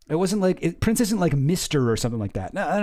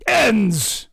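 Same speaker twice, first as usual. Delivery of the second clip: exasperated; 7 semitones higher, 4 dB louder than usual. Real, not acted. Exasperated at the ending.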